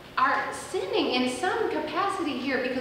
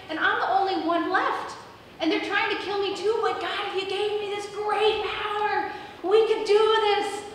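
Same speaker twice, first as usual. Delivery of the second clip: high-pitched, insistent protest; Elijah's impassioned plea; 6 semitones higher than usual. Acted. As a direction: plead your case urgently to God. Acting Elijah's pleading complaint to God.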